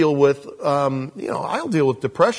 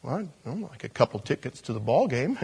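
much softer, lower, offhand reply; sheepish mumble; 4 semitones lower, 8 dB quieter than usual. Acted. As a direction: shrug it off sheepishly. Blind man's sheepish request.

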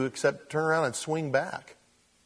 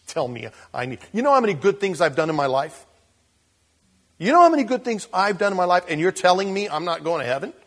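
prideful person; 5 semitones higher, 7 dB louder, faster than usual. Retold or imitated. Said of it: "I'm just like".

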